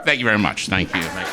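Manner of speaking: usual speaking voice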